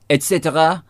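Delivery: et cetera with French r; teasing French accent. Acted. Needling co-host who hates this, French accent. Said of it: "in a French accent".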